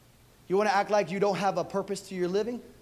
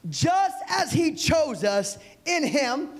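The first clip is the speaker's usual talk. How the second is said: preaching a verse; 8 semitones higher, much slower than usual. Acted. Preaching reading Ephesians.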